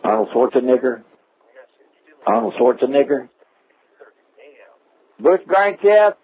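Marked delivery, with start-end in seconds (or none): none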